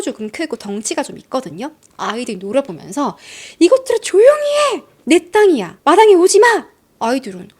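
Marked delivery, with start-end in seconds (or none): 3.6-6.7 much higher, much louder acted screech at kids; woman shouting at children to get off her lawn; angry neighbor yelling. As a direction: yell it like a furious neighbor chasing kids off.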